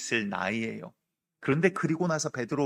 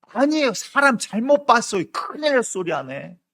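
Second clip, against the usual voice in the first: much higher and louder, almost a yelp; panicked loud denial; 9 semitones higher, 8 dB louder than usual. Retold or imitated. Peter, cornered by another woman, frantically shouting he is mistaken. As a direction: deny it in a frantic rush.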